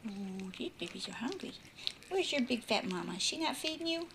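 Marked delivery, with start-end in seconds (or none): none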